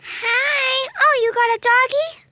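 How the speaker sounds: high, loud greeting in a put-on voice; high-pitched pretend cat character; cat family member. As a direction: squeak a delighted welcome.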